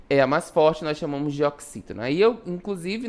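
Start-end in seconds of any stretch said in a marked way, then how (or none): none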